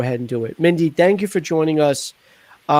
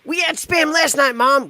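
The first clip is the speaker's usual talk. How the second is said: put-on kid whining; 8 semitones higher than usual. Acted kid complaining to mom.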